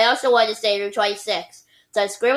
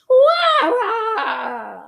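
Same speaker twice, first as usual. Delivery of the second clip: very high loud crying whinny; whinny badly, like a dog; horse whine; 13 semitones higher, 4 dB louder than usual. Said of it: "He tried to whine too".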